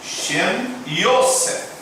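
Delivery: drawn-out name, syllable by syllable; slow, careful pronunciation; slow model reading. Shown as a demonstration. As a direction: spell it out by ear for learners.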